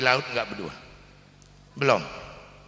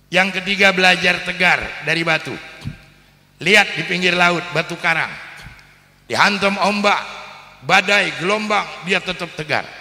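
rousing preaching; 4 semitones higher than usual. Acted. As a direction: preach it out to the whole congregation. Preacher builds to rousing point. (khotbah berapi-api dengan suara lebih tinggi dan keras)

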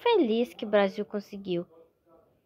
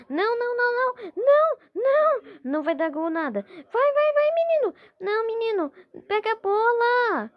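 frantic high cheering; 9 semitones higher, 4 dB louder than usual. Real, not acted.

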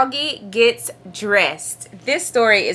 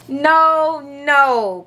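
long, raised wail of protest; crying out; 2 semitones higher than usual. A narrator's reading. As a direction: cry out like a protesting child. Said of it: "cried Froggy".

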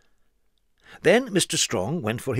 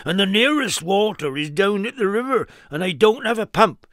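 louder, higher fretful protest; worried farmer; 8 semitones higher, 3 dB louder than usual. Acted. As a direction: plead helplessly. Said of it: "cried the worried farmer".

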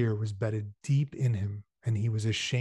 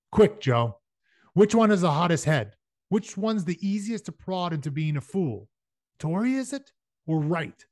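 higher, pressing questions; urgent questioning; 6 semitones higher, 4 dB louder than usual. A narrator's reading. Shane pressing Father for a name, urgent. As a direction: press for a quick answer.

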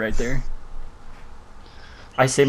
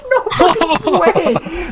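laughing disbelief; 3 dB louder, much slower than usual. Real, not acted. Teammate's wild play.

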